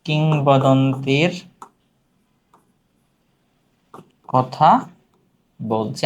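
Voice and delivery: slow, drawn-out words with long pauses; word-by-word dictation. Shown as a demonstration. (থেমে থেমে, টেনে টেনে বলা)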